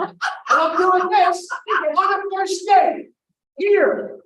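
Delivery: high, loud, drawn-out disbelief; panicked shouting. Acted. Panicking man reaches over with a napkin, high frantic shout. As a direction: yell it in mortified panic, voice way up.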